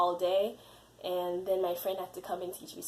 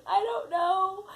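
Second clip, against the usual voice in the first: very high, drawn-out retold wail; mock tearful helplessness; 12 semitones higher, 4 dB louder, slower than usual. Crying candidate to interviewers asking what's wrong, mock helpless wail. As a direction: act helpless, slow and pitched way up.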